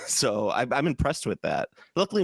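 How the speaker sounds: ordinary talk, nothing marked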